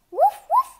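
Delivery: high, loud yappy woof; loud puppy bark. Acted. Puppy barks back at its owner, high loud woof. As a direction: yap it out like an eager little dog.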